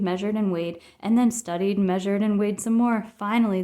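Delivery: ordinary delivery, nothing marked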